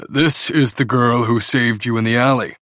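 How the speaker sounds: a hint of a curious, commanding father; intrigued father. Father questions Payton, intrigued.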